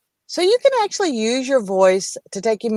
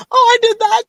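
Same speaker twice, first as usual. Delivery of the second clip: high, loud squeal of recognition; mock self-realization; 6 dB louder than usual. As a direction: realize it all at once, with a laughing gasp. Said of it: "laugh at myself going".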